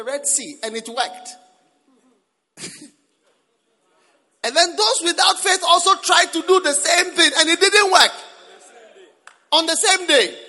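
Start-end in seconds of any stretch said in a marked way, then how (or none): 4.4-10.3 loud sung preaching cry; shouting. Real, not acted. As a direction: preach it out at full force. Preacher building to climax.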